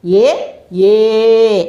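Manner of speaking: short ఏ, then ఏ held long and level; teacher sounding out short then long ఏ. Shown as a demonstration. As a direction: demonstrate ఏ first brief, then drawn out. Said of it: "ఆ అక్షరాలు ఏవేమిటో చూద్దాం".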